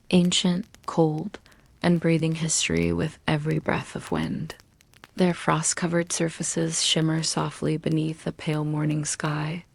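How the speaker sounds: usual speaking voice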